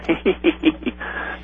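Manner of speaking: high, airy imitation cackle; mock hen cackle. Caller mimics the campaign callers, mock cackling. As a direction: imitate a room of cackling women. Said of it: "they were all".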